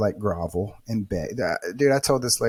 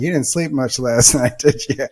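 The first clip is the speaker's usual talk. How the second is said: laughing buddy; 4 semitones higher, 7 dB louder than usual. Retold or imitated. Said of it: "He busted out laughing".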